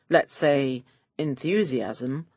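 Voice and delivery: plain reading voice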